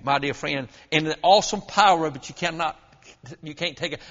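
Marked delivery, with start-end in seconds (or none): none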